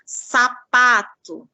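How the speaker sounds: SAPATO said slowly, SA then PATO; syllable demo. Shown as a demonstration. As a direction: stretch "sapato" out clearly for the class. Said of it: "ter uma palavra e subtrair uma sílaba".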